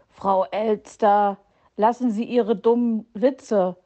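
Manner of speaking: usual speaking voice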